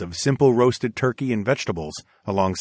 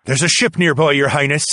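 louder, raised captain's voice; grim ship's captain; 5 semitones higher, 4 dB louder than usual. Acted. Captain warning the princess of pirates, grim and loud. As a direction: report danger grimly and forcefully. His face looked grim.

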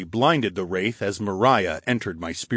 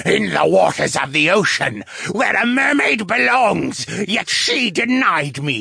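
wraith's screech; 9 semitones higher, 4 dB louder than usual. A narrator's reading. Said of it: "the wraith screeched".

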